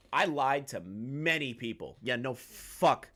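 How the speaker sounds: usual speaking voice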